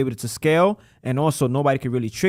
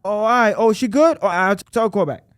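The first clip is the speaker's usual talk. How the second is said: higher, louder client backtracking; confused client; 9 semitones higher, 3 dB louder than usual. Retold or imitated. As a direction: caller realising it's legit, sheepish. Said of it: "They're like".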